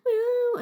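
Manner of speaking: high, loud plaintive meow; cat meow. Retold or imitated. Imitating the cat crying on the chair, meowing. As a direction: cry like the scared cat.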